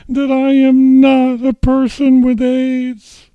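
high, loud and slow; shouting through tears. Retold or imitated. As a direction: scream it out, over and over. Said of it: "And I said".

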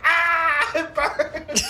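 high, loud mock-agonized wail; imitated pained scream. Frank reacting in agony, hammed-up scream. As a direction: wail it out, half laughing.

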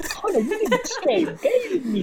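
high, slow, laughing through it; laughing hard at being tricked. Real, not acted. Friend tricked into reacting to joke.

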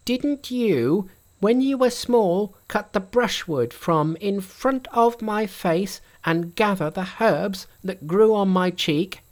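high, bright monkey king voice. A narrator's reading.

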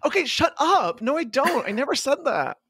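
defensive. Real, not acted. Denying a friend's tease.

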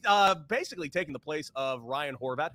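ordinary talk

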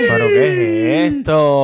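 high, slow shocked outburst; surprised cry. Real, not acted. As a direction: gasp it in disbelief.

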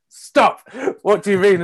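a hammed-up stop from the coach; retold sharp stop. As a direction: cut the rehearsal off with a startled shout. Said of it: "And you said".

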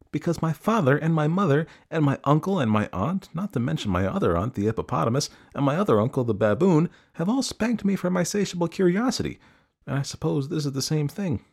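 put-on earnest young elephant. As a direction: explain it all in an innocent high voice. Elephant's child explains to the snake.

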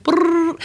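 high trilled "brrr"; high gobbling trill; turkey-like howl attempt. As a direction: imitate a little kid's botched howl. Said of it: "you sound like a turkey".

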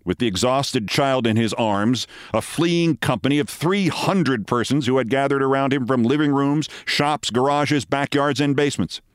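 usual speaking voice

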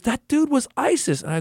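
put-on a child repeating himself, sure he's right; insistent little kid. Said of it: "He goes".

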